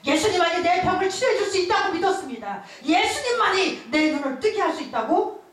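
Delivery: building fervent preacher delivery; fervent preaching. Acted. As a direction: preach it to the congregation with conviction. Preaching at full force.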